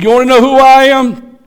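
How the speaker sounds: bold challenge. Acted. John answers the delegation.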